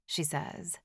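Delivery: ordinary delivery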